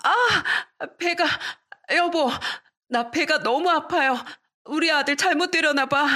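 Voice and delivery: clutching belly in pain; pain moaning. A narrator's reading. Pregnant wife cries to husband, in pain. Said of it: "배를 잡고 구르기".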